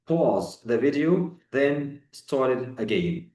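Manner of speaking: slow, deliberate English instruction; slow teacher English. Shown as a demonstration. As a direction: spell it out slowly for learners. Teacher models English instruction slowly. (نطق بطيء وواضح)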